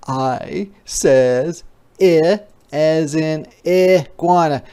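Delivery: normal talking voice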